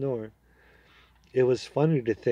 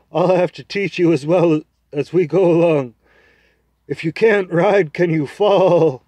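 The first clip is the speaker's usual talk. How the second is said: proud talking horse, higher and louder, persuading; a confident horse making its case to the boy; 6 semitones higher, 11 dB louder than usual. Acted. Horse scolds Shasta.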